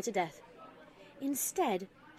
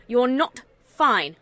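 loud and slow, pressing each word; insistent; 10 dB louder, slower than usual. A narrator's reading. Mother losing patience with her daughter, loud and slow. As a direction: hammer it home, louder and slower.

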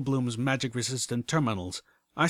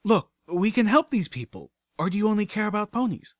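high, loud, challenging plea; heated appeal; 10 semitones higher, 5 dB louder than usual. Acted. Narrator challenges Watcher's refusal to help, indignantly pleading. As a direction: press hard, pitched up and louder.

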